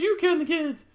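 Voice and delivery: high, loud mock accusation; Sarah. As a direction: fire it back in a put-on high voice. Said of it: "And she's like".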